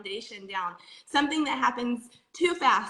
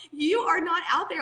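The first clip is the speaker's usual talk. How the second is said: ordinary talk, nothing marked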